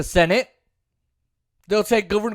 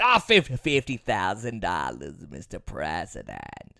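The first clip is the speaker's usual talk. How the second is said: Schumer impression; 9 dB quieter than usual. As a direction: drag it out low like an old senator. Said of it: "That's how he talks".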